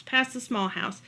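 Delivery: plain reading voice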